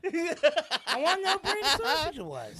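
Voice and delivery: loud, high, slow protest through laughter; laughing protest. Real, not acted. Friend defends his sausage question, laughing protest. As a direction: insist while laughing. Teased, defends asking about brand.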